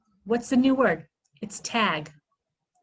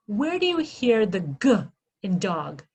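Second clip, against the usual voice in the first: ordinary talk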